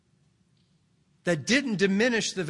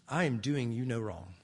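softer, lower calm reply; softer, lower calm reassurance to a friend; gentle master; 7 semitones lower, 6 dB quieter than usual. Acted. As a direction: answer kindly, not angry. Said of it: "Still a friend. Doesn't get angry".

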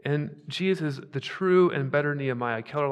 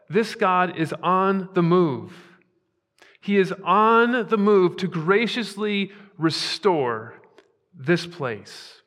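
rising, louder, slow preacher's proclamation; full-force preaching; 4 semitones higher, 7 dB louder, slower than usual. Acted. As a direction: preach it out, stretching each phrase. Preaching climax.

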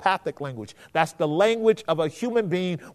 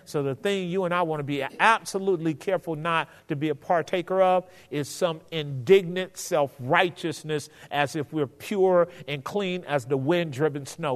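normal talking voice

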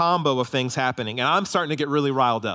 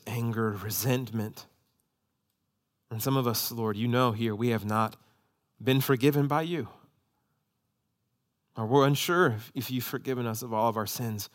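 prayer; 4 semitones lower than usual. Real, not acted. Pastor prays over the congregation.

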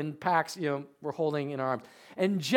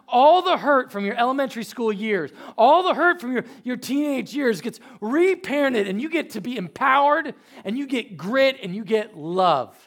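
rising, fervent preacher's build, louder and higher; preaching; 8 semitones higher, 10 dB louder than usual. Real, not acted. Pastor urging the church toward healing, preaching. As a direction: pour it out to the congregation.

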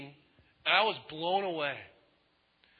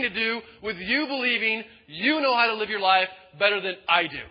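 loud, high, forceful confrontation; acted divine rebuke, insistent and raised; angry God; 5 semitones higher, 6 dB louder than usual. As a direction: lay down an ultimatum in anger. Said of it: "the first time God gets angry".

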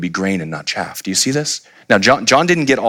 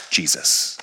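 whispered; 7 semitones lower, 5 dB quieter than usual. Real, not acted. Climax of sermon point.

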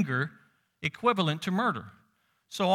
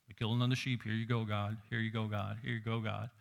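softer, lower, offhand mock worshipper voice; a hint of lazy worshipper, softer and lower; mock careless worshipper; 7 semitones lower, 8 dB quieter than usual. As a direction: toss it off like a bored sacrifice. Preacher mocks careless sacrificers.

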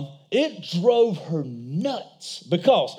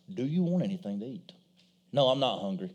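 quiet dad voice; 10 semitones lower, 8 dB quieter, faster than usual. Retold or imitated.

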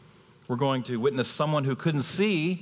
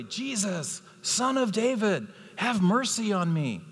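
crying out; 8 semitones higher than usual. A narrator's reading. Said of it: "he began to cry out and say".

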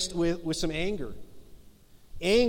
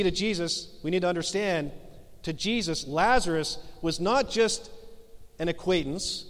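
ordinary talk, nothing marked

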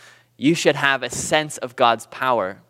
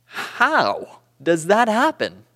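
usual speaking voice

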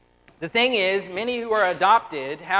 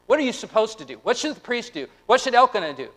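ordinary talk, nothing marked

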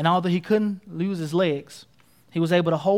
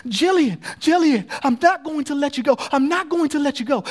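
raised, insistent cry to a friend; acted screaming; 7 semitones higher, 4 dB louder than usual. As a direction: refuse to let go, calling out firmly. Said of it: "[name] is still screaming".

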